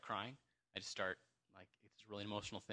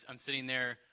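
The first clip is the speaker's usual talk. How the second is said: usual speaking voice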